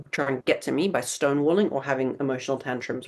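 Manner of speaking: ordinary delivery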